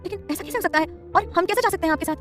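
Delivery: can't believe it; wife startled by her husband's travel plan, high; surprised. Acted. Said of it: "हैरानी से बोली".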